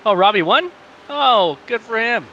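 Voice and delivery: high, loud surprised, then slowing. Real, not acted. Surprised at result.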